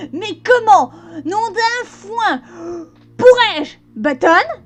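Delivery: loud shocked pony outcry; outraged exclamation. Acted. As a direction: burst out in shock. Mother scolds Button.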